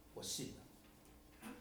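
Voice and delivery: barely voiced, very slow whisper; a whispered, very slow I believe; whispered confession. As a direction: breathe it out softly and slowly. The prisoner's quiet confession of faith.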